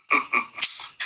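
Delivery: soft, breathy short chuckle. Real, not acted. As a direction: chuckle quietly before going on. Laughs after his point.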